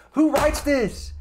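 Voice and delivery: high, loud and slow in disbelief; real yell. Real, not acted. Reacting to the musical to his viewers, a stunned high yell. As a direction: shout it out, stunned by the writing. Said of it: "I feel like I'm screaming into the mic".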